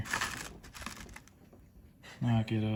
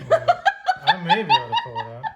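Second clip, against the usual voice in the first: laughing; 9 dB louder, faster than usual. Real, not acted. Laughing at the innuendo.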